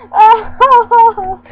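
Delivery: loud, high peals of real laughter; big belly laugh. Real, not acted. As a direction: burst out laughing at the punchline. Cracks up at own punchline.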